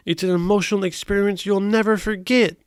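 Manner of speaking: lightly voiced enthusiastic John; voicing John. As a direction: sell the sunrise earnestly. Reading John's line.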